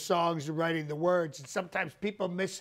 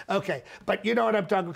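ordinary talk

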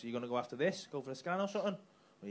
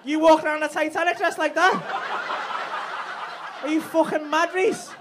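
mock outrage; 7 semitones higher, 4 dB louder, slower than usual. Real, not acted.

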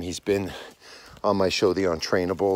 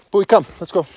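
loud, high call to the dog; sharp high command to the dog; 10 semitones higher, 8 dB louder, faster than usual. Real, not acted. Calling the dog to follow.

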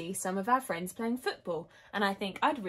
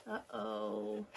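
low, soft aside; ominous uh-oh; 10 semitones lower, 8 dB quieter than usual. A narrator's reading. Reader reacts to the missing dog, low and ominous. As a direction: drop down low and quiet, trouble looming.

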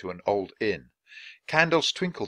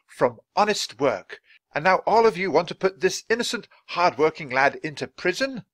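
raised, forceful public rebuke; indignant Dickens; 8 semitones higher, 6 dB louder than usual. Acted. Dickens shames the crowd for accusing the boy, indignant. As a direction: shame them in a high, loud orator's voice.

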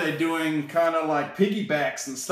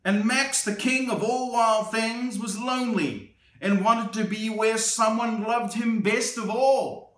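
lively picture-book read-aloud; storybook narration for a little one; reading to children; 7 semitones higher than usual. A narrator's reading. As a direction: read it like bedtime story time.

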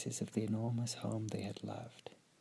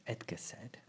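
ordinary delivery

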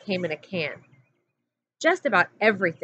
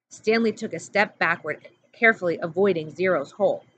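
ordinary delivery

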